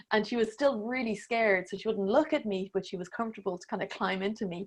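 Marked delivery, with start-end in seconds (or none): none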